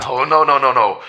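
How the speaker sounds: loud, high repeated no; frantic, rising refusal; shouting no in horror; panicked denial. Acted. Priest refuses the revelation.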